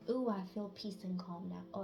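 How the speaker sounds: ordinary talk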